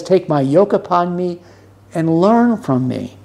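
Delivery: ordinary talk, nothing marked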